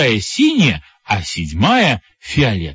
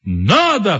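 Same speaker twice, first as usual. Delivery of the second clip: chorused cry; 8 semitones higher than usual. A narrator's reading. Seven heads answering all together, crying out. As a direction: call out eagerly, understated volume. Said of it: "хором крикнули все семь голов".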